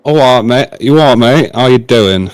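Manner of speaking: lightly put-on Cockney; mock Cockney greeting voice; Cockney impression. Shown as a demonstration. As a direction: have a tentative go at a Cockney accent. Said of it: "can you do a Cockney?".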